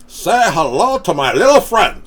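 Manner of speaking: put-on loud, high Scarface-style Cuban accent; Tony Montana. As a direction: shout it like Scarface.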